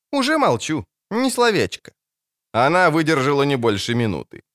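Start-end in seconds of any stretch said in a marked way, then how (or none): 0.1-1.9 high, mock-obedient; cheeky girl. Ciri mock-obediently promises the dwarf silence.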